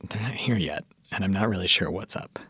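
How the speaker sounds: hushed aside to the listeners; whisper. Acted. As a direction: drop to a secretive whisper. Host confiding the producer is missing.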